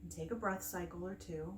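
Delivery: calm meditation guide with long pauses between phrases; unhurried, soothing breathwork instruction; guided meditation. Acted. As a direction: gently lead them through the breathing, leaving space after each phrase.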